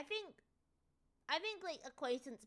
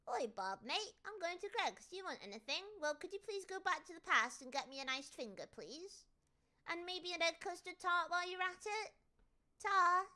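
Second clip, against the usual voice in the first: lightly caricatured British mate on an errand; mock-British back-and-forth; hammed-up British banter; 6 semitones higher than usual. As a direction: play up the cheeky British chat. Acting out a British errand exchange.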